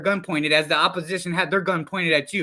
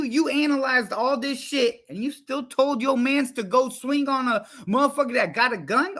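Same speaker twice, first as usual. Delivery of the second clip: ordinary talk, nothing marked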